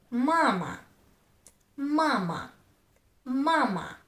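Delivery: MA-ma, first syllable of "mama" held longer; stress on first syllable. Shown as a demonstration. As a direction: lengthen the first "ma" in "mama", second short. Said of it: "One of syllables I pronounce longer".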